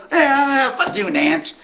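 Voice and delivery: a trainer brushing off a joke, easygoing; his easygoing tone. Retold or imitated. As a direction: shrug it off, no heat at all. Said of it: "just his tone".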